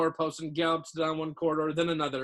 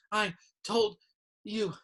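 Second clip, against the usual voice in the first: higher, broken into short gasps; gasping; 6 semitones higher, slower than usual. A narrator's reading. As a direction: gasp it out word by word, winded. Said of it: "Hermione gasped".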